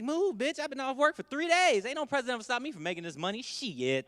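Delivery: loud, fast, bossy street voice; loud brash mock worker. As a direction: shove past the crowd, yelling. Voice of working person.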